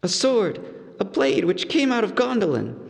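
hobbit voice. A narrator's reading. Bilbo warning Gollum about his sword, proud. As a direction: show off the blade.

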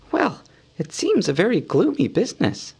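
high, light hobbit voice; a small, fretful hobbit muttering; Bilbo voice. A narrator's reading.

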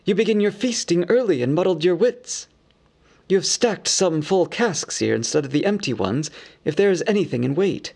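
lightly voiced scolding elves. A narrator's reading. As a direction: tell him off lightly. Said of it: "cried some".

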